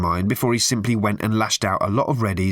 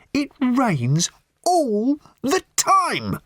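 very slow, high-pitched raving; 14 semitones higher, much slower than usual. A narrator's reading. Lorry driver thumping the table at Arthur, raving. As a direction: rave it word by word, thumping out each one. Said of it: "raved the man".

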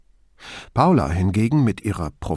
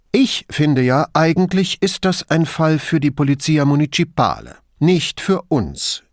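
higher, louder fussy bureaucrat voice; pedantic official; 6 semitones higher, 4 dB louder than usual. Acted. Brunetti plays a fussy official for Borgato, pedantic. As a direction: act the nitpicking civil servant. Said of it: "kehrte Brunetti den pingeligen Beamten heraus".

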